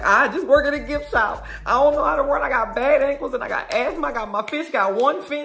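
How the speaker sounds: high, babbling mock panic; panicked, nervous. Gift shop worker begging off, acted nervous panic. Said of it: "he is panicking and he's starting to, like, get nervous".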